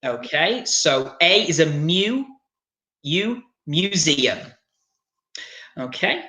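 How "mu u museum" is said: slow teacher's "u" as "you" in "museum"; "museum" built up slowly from "myoo"; broken-down pronunciation. Shown as a demonstration. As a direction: draw out "mu" as "myoo", pause, then "museum". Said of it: "So it's like mu".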